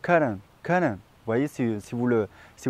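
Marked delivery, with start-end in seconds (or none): 0.0-0.9 cotton with both T sounds dropped, almost kah-n. Shown as a demonstration. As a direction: cotton swallowing the T, stress on the first syllable. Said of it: "fait sauter les deux T".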